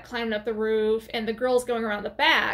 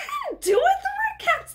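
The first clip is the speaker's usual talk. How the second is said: very high, whining mock denial; panicked protest; faster than usual. Maya blames the red caps to Jamie, mock panic. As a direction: protest innocence in a squealed panic.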